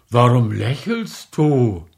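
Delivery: husband snaps at his smiling wife; snapping. Acted. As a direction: bite her head off. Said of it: "fuhr Pawel Iwanitsch seine Frau an".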